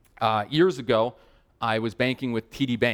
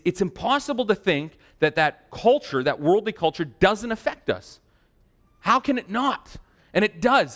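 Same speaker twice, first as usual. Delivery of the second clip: high-pitched, emphatic sermon delivery; impassioned preaching; 6 semitones higher than usual. Real, not acted. Preacher tells congregation of God's commitment, impassioned preaching. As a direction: drive the point home to the congregation. Preaches the point after the story.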